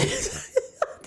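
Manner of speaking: a sudden high laugh, then breath; high burst of laughter. Real, not acted. Laughs at quoted baby mom.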